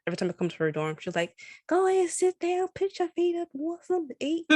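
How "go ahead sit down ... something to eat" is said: high, welcoming Southern-hostess voice; imitating a friend fussing over a guest, pitched way up; hammed-up sweet Southern host; Southern hospitality friend. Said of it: "Southern hospitality type of person".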